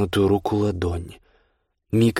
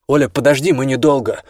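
higher, louder call across the room; shouting; 5 semitones higher, 6 dB louder than usual. A narrator's reading. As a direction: call after her to wait. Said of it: "крикнул Данилевский".

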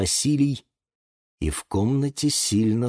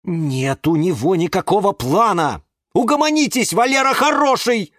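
losing patience; 11 semitones higher, 5 dB louder than usual. A narrator's reading. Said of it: "начал терять терпение Юра".

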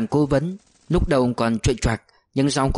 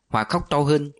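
ordinary delivery

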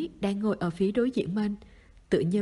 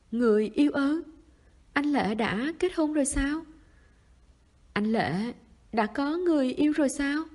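high-pitched, dismayed questions; flustered asking; 5 semitones higher, 3 dB louder than usual. A narrator's reading. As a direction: ask in flustered disbelief, voice lifted high. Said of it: "Tưởng Linh Linh lúng túng hỏi". (lúng túng hỏi, giọng cao bối rối)

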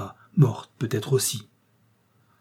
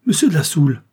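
louder, higher startled cry; shocked cry; 4 semitones higher, 6 dB louder, faster than usual. A narrator's reading. Robert recognises the prisoner and cries out in shock. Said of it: "il poussa un cri".

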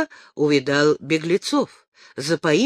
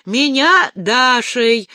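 loud and slow, pitched up; shouting; 6 semitones higher, 6 dB louder, slower than usual. A narrator's reading. Dasha shouts her name right into the ataman's ear, loud. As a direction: strain your voice to get it into a deaf ear. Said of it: "в самое ухо атамана прокричала Даша".

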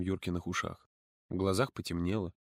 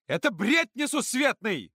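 higher, slow furious counselor; angry shout; 12 semitones higher, 4 dB louder, slower than usual. A narrator's reading. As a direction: yell it in outrage. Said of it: "закричала Ира". (Ира кричит гневно)

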